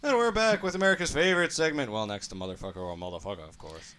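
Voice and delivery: high, loud segment intro; showy radio-host intro; hyped announcer. Acted. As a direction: announce it like a big show opener.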